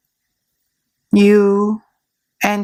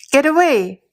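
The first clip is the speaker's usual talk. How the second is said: mild mock yell; 5 semitones higher, faster than usual. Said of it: "you yell at him".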